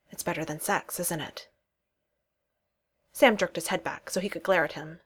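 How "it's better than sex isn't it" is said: a hint of menace, dropped softer; lightly voiced lowered teasing murmur; low and dangerous. A narrator's reading. Josh pressed at Sam's ear, teasing him, softly menacing murmur. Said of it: "his voice went suddenly low and dangerous".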